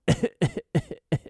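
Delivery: short, high bursts of coughing; imitated cough. Dad acting out his son at the doctor, imitated coughing. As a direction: put on a child's cough.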